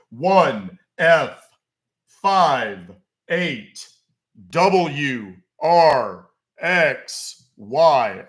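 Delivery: loud, very slow, fed up; exasperated dictating. Real, not acted. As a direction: spell it out again, exasperated. Repeating password exasperated.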